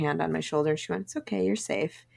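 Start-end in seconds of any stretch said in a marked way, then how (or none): 1.0-2.0 softer, slightly higher soothing voice; gentle reassurance. Retold or imitated. Friend soothing her when frustrated, gentle reassurance. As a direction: imitate a friend calming someone gently.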